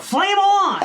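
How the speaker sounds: high, loud theatrical yell; Vision's battle cry. Acted. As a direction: shout it out like a superhero's catchphrase. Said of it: "Vision yells out".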